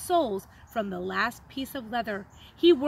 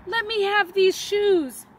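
high, louder desperate plea; a stranger begging the shoemaker; 6 semitones higher, 6 dB louder than usual. A narrator's reading. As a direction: beg him to give them up. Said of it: "he begged".

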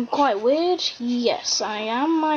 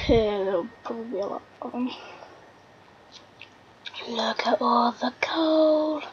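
whisper. Real, not acted. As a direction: breathe it out in wonder. Whispering at found coal.

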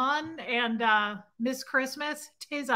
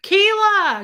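much higher and louder, a drawn-out happy call; excited call; 8 semitones higher, 12 dB louder than usual. Real, not acted. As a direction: shout her name like a birthday surprise. Calling out a birthday viewer.

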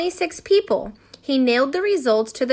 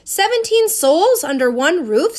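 high, loud disbelieving question; astonished question; 6 semitones higher, 6 dB louder than usual. A narrator's reading. Phineas reacting to seventeen people in one house, astonished. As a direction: blurt it out in amazement.

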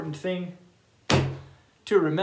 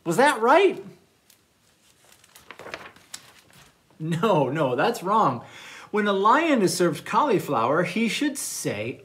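animated storytime narration with big swings in pitch; reading to children; 4 semitones higher, 6 dB louder than usual. Acted. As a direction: read a playful picture book to little listeners. Reads manners story to children.

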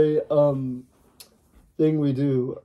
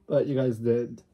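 normal talking voice

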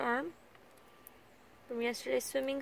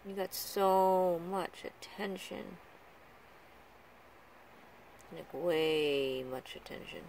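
drawn-out, lower teasing; slow teasing; 5 semitones lower, slower than usual. Real, not acted. Owner teasing her pet that the attention is over, slow and low. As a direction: tease the pet slowly, savouring it.

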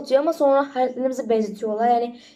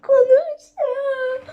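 high, slow, laugh-choked words; can't get the words out for laughing; giggling too hard to speak; embarrassed laughing; 12 semitones higher, 4 dB louder, slower than usual. Real, not acted. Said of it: "Çok rezil oldum".

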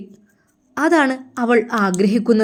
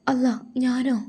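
breathless, scared whisper; a girl too afraid to go upstairs, whispering; frightened whisper; 3 semitones higher, 5 dB quieter than usual. Acted. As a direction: shrink back and whisper it. Frightened girl refuses the errand.

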